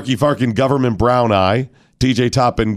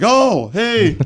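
surprised; 11 semitones higher than usual. Retold or imitated. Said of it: "they're like".

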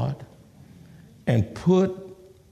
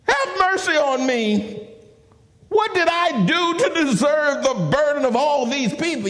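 high, drawn-out plea to God; exasperated complaint in a raised voice; aggravated Moses; 13 semitones higher than usual. Acted. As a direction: plead your case, pitched well up. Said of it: "Moses was also very aggravated".

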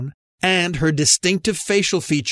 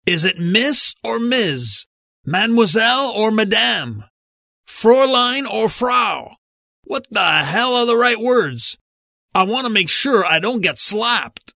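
higher-pitched, hammed-up grandfather voice; theatrical old-relative bluster, pitched up; over-the-top grandfather; 6 semitones higher than usual. A narrator's reading. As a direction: declaim it like a grandfather making a grand, exasperated pronouncement. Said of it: "imagining one of his grandfather's over-the-top statements".

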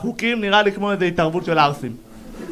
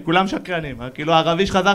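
usual speaking voice

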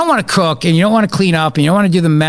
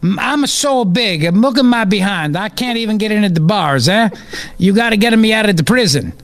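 mock Italian accent; 4 semitones higher than usual. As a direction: plead for release in hammed-up Italian English. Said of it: "fat ass Italian who was released from prison".

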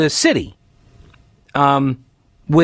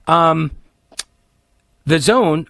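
ordinary talk, nothing marked

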